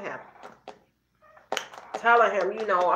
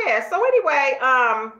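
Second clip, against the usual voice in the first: usual speaking voice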